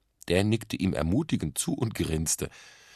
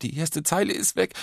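high-pitched, desperate inner cry; verzweifelt; 10 semitones higher, 3 dB louder than usual. A narrator's reading. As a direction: realise it with mounting panic. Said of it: "dachte er verzweifelt". (verzweifelt, mit hoher Stimme)

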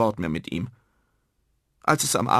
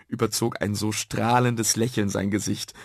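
ordinary delivery

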